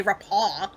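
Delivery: "repaw" ending in an open aw; quoted mispronunciation. Retold or imitated. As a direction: say "repaw" without the r. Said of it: "never heard anyone say repaw".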